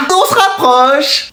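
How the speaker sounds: slow, high teasing encouragement; coaxing hint. Acted. Teacher to student guessing the meaning, slow high teasing coax. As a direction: draw it out to coax the answer.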